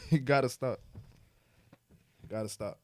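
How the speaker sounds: normal talking voice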